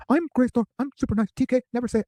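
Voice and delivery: high-pitched mock girl-next-door voice; Grace Thor. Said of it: "But she's just like".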